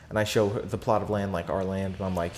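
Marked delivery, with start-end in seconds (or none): none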